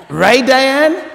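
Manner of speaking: high, loud laughing question; laughing call-out. Real, not acted. Preacher teasing Diane in the congregation, laughing.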